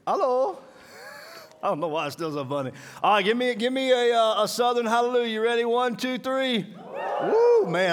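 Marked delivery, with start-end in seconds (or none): 0.1-0.6 hello again. Acted. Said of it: "Did I say it wrong?". 3.0-6.7 higher, louder hype-man count-in; revving up the crowd. Acted. Preacher hyping the congregation for a Southern hallelujah, high and loud. 7.2-7.9 high, loud joyful woo; excited whoop. Real, not acted. Preacher whooping after the crowd's hallelujah, high and loud.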